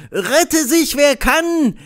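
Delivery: high, pleading cry of panic; desperate cry. A narrator's reading. Official in his underwear crying out desperately in the crowd. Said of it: "rief mit verzweifelter Stimme".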